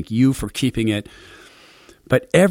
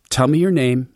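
usual speaking voice

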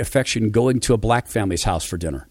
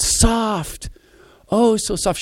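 put-on little girl's wonder; 11 semitones higher, much slower than usual. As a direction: marvel like a small child. Girl touches hair in wonder.

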